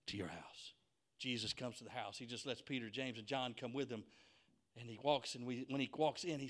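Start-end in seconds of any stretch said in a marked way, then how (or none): 0.1-0.7 low, soft, near-whisper; calm quiet reply. Acted. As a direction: answer the outburst very gently. Jesus calmly answers the outburst.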